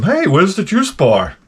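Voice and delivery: lightly voiced cheerful gym guy; gym bro voice. Mocking gym guys.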